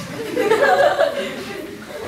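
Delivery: airy high-pitched laugh of disbelief; a silent, wheezing giggle; breathy high laugh. Real, not acted. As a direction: laugh at how absurd it sounds. Reacts to study hours.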